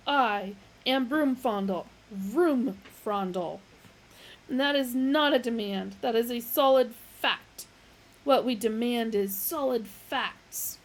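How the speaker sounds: indignant philosopher banging desk; loud insisting. A narrator's reading. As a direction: hammer each sentence. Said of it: "bawled Roomfondle".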